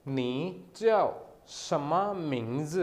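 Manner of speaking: नी च्याओ शमा मिंग्स said slowly, with च्याओ rising and carefully spaced; slow model sentence. Shown as a demonstration. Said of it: "इसका मतलब होता है name".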